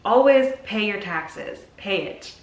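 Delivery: ordinary talk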